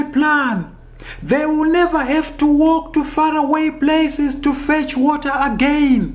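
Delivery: high, loud voice of the sly hare calling after Tortoise; Tsuro shouting. Acted. Tsuro shouts after the departing Tortoise, high and urgent. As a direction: call out after him in a high, pleading trickster voice. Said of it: "Tsuro shouted after him".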